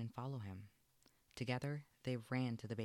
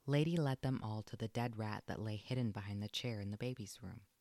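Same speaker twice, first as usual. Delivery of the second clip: ordinary delivery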